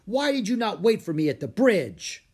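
loud, high reproachful question; calling out. A narrator's reading. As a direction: scold stray pupils from a distance. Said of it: "he called out to Jack and Annie".

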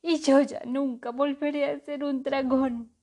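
loud, high wailing lament; bawling. A narrator's reading. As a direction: bawl for the lost body. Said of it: "berreó el cuervo".

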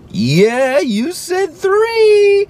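very high, slow, drawn-out cheer; delighted praise. Retold or imitated. Dad praising his toddler warmly though he said T. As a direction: cheer as if he got it right. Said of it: "Even though he said T".

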